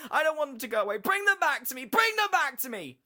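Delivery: high, full-force wailing demand; shrieking, panicked child voice; children screaming. A narrator's reading. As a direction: scream it in horror and pain. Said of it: "They all screamed out".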